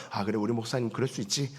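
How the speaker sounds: soft, quick shrugging it off; easy shrug. Retold or imitated. Member forgiving a charismatic pastor, soft quick shrug. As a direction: brush it off lightly under your breath.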